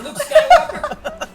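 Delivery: high, slow laughing squeal. Real, not acted. Laughing punchline.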